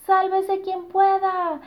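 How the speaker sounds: high, loud, slow panicked cry; panicked shout. Acted. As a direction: yell it while fleeing. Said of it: "gritaban todos mientras escapaban". (grito de pánico agudo)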